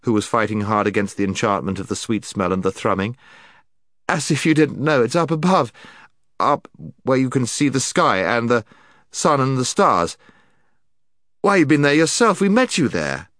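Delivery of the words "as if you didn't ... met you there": high, loud, heated protest; Scrub. A narrator's reading. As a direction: argue hard in a raised, exasperated voice. Scrub fights the enchantment, arguing.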